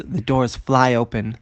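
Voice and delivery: ordinary delivery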